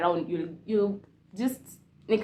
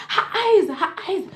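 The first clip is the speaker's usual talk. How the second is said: high, loud mock alarm from the worshippers; panicked cry; 11 semitones higher, 8 dB louder, slower than usual. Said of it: "they're like".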